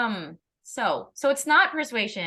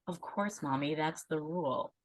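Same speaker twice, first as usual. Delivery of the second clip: put-on much softer, matter-of-fact kid voice; son's matter-of-fact voice; 5 semitones lower, 8 dB quieter than usual. Neurodiverse son telling his mommy the rule, softly and matter-of-fact. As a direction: hand it over quietly as obvious. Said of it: "he'll be like".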